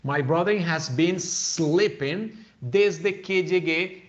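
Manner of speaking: "My brother has been sleeping" spelled out slowly; slow model sentence. Shown as a demonstration. Teacher reading the example slowly to students, word by word. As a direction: read it slowly and clearly for the class. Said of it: "we say here".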